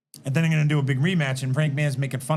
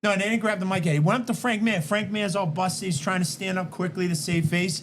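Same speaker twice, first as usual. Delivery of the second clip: usual speaking voice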